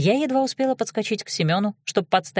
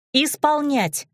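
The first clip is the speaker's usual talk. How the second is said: sharp command; 7 semitones higher, slower than usual. A narrator's reading. Heroine orders the guard about.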